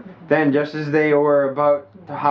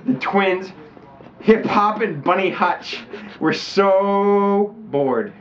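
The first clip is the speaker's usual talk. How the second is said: higher and very slow on so bored; drawn-out, higher mock-dramatic reading; dramatic storybook drag; 5 semitones higher, much slower than usual. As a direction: stretch out so bored like a bored child. Hamming up the storybook line.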